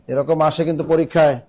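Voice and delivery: ordinary delivery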